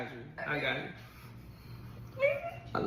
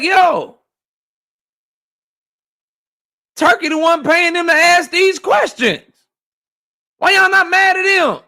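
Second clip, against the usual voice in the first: raised, higher voice re-acting his rant; heated retold shout; 7 semitones higher than usual. Retelling his own heated question.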